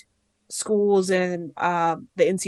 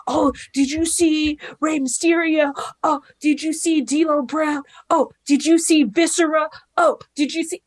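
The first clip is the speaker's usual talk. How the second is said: excited friend; 8 semitones higher, 3 dB louder than usual. Retold or imitated. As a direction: gush about every wrestler like an excited fan.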